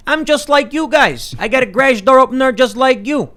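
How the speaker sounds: high, loud folksy put-on voice of a vote-hungry politician; pandering politician impression. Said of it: "the politician could go to Chicago and be like".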